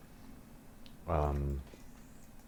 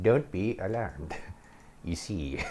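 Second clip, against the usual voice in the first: hammed-up witch, dropping softer at the end; weird witch voice; 4 dB quieter than usual. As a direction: imitate a creepy witch. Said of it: "with this weird witch voice".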